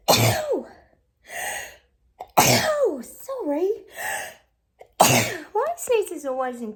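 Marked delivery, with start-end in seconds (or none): 0.1-0.7 sharp sneeze with a yelp; loud sneeze. Real, not acted. Sneezing fit. 2.4-3.0 sharp sneeze with a yelp; loud sneeze. Real, not acted. Sneezing fit.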